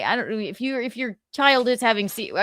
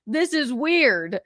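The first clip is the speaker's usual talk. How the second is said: retold protest; 8 semitones higher than usual. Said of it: "I'm like".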